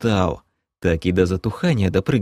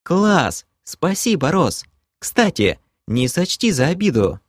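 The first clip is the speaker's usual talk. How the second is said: put-on higher, excited young woman; delighted and chirpy; bright girl voice; 8 semitones higher than usual. Kelen excited thanks, female voice.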